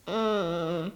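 low, feigned moan; pained groan. A narrator's reading. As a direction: groan as if in pain. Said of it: "Kurt stöhnte". (tiefes, gespieltes Stöhnen)

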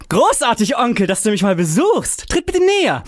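high, hammed-up greeting to an imaginary uncle; loud fake welcome. A narrator's reading. Fabian stages a loud, high welcome for the landlady's ears. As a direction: overplay the delight so the landlady hears. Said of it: "schlug sie wieder zu und rief".

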